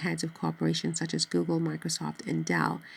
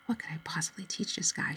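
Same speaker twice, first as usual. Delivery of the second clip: unvoiced inner whisper, softer; whispered thought; 6 dB quieter than usual. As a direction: whisper it to herself. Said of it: "she wondered".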